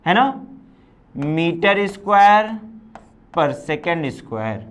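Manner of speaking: Metre square per second square said very slowly; slow dictation. Shown as a demonstration. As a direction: spell out the unit slowly while writing. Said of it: "ऐसे भी लिख सकते हो". (धीरे-धीरे लिखवाते हुए)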